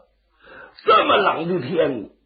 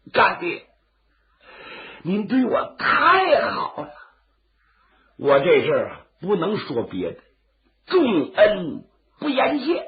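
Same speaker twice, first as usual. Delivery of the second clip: tearful cry. Acted. Zhang kneels weeping, thanking him.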